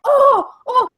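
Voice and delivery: high, loud dawning realization; Marco Polo. Acted. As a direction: imitate a confused foreigner finally catching on. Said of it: "he's like".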